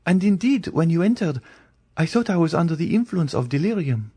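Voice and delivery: put-on a terrified girl refusing to believe; frightened young Valentine. A narrator's reading. Valentine describes night shadows.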